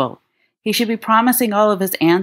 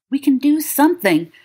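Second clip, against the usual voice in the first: breathless; 8 semitones higher than usual. A narrator's reading. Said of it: "James protests breathlessly".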